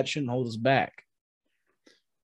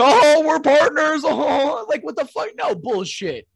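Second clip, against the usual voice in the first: high, slow, louder mocking voice with a laugh; mock Riddler; 11 semitones higher, 6 dB louder, slower than usual. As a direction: send up the Riddler as clueless and giddy. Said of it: "Riddler's like".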